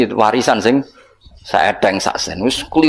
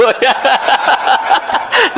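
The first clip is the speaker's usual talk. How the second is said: laugh; 12 semitones higher than usual. Real, not acted. Punchline about lazy heaven.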